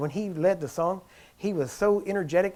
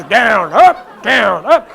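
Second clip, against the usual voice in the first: very loud barked commands; imitating Jack Coe; 4 semitones higher, 14 dB louder, much slower than usual. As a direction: bellow like a rough faith healer. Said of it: "And he's".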